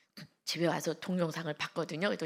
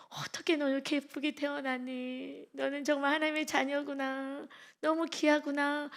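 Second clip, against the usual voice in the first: tender cooing; 6 semitones higher than usual. Retold or imitated. Speaking tenderly to baby.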